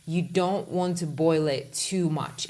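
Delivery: ordinary talk, nothing marked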